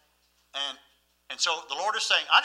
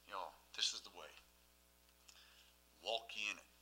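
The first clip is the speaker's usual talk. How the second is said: hushed scripture refrain; 11 semitones lower, 17 dB quieter than usual. Acted. Quiet reverent refrain of scripture.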